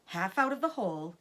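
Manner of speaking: ordinary delivery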